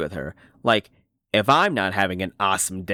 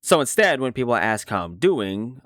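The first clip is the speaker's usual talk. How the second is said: ordinary talk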